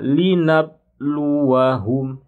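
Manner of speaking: slow melodic linabluwahum with a clear qalqalah; bounced qalqalah. Shown as a demonstration. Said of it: "tidak dibaca biasa".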